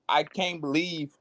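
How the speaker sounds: normal talking voice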